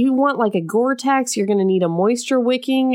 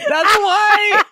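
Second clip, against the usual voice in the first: high, loud laughing burst; laughing shout; 12 semitones higher, 5 dB louder than usual. Real, not acted. Joking about the heroine's name.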